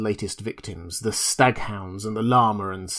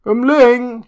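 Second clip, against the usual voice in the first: very high, loud call into the night; 12 semitones higher, 8 dB louder than usual. A narrator's reading. Drunk doctor calling for Umling outside, very high and loud. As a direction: call his servant from afar. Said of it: "he cried".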